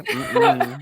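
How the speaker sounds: squealing disapproving laugh; high squeal of laughter. Real, not acted. Reacting to crude joke.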